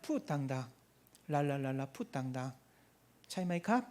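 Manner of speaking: softly voiced imitation of a Thai prayer leader; mock Thai prayer coaching. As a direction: hush it down like leading someone in prayer. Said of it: "이게 태국어로 풋 당당이거든요".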